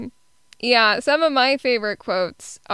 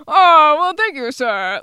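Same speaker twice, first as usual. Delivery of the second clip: Roddy; 5 semitones higher, 7 dB louder than usual. Retold or imitated. Answering an interviewer, exaggerated imitation of thanks. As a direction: open big, then drop to usual pitch. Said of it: "like, exactly like that".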